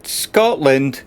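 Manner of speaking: usual speaking voice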